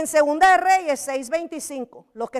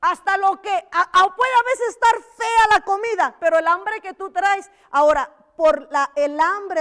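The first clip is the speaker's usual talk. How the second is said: raised preaching voice; 5 semitones higher, 7 dB louder than usual. Real, not acted. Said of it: "preacher raises voice".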